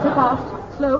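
ordinary talk

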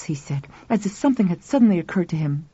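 plain reading voice

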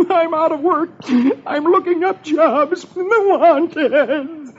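high, slow comic blubbering; hammed-up sobbing. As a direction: wail through mock tears. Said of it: "Tears pour out of his eyes as he sobs".

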